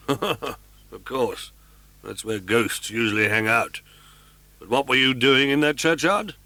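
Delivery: wry, sarcastic agreement; commissary teasing then probing; dry mockery. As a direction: humour him, then press. Commissary mocks Raoul's answer.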